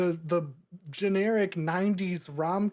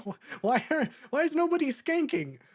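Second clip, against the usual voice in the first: much higher, laughing disbelief; incredulous laughing why, pitched up high; baffled; 7 semitones higher than usual. Retold or imitated. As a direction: squeak out the question through a laugh. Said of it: "I'm like".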